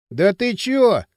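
usual speaking voice

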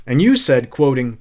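ordinary delivery

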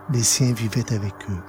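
ordinary delivery, nothing marked

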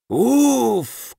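высокий резкий вскрик «уф»; вскрикнуть от жара в бадье; вскрик меча «уф». A narrator's reading. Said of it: "крикнул меч". (меч вскрикивает от жара)

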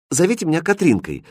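put-on high, quick girlish interruption; interrupting. A narrator's reading. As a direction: cut in briskly in a high voice. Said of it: "перебила его девушка". (быстро перебивая)

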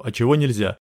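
ordinary delivery, nothing marked

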